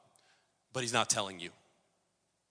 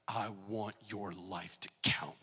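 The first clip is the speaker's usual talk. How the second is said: soft, slow voice of the Spirit; 5 semitones lower, 7 dB quieter, slower than usual. Retold or imitated. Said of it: "Holy Spirit ... saying".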